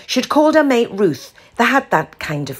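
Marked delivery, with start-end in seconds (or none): none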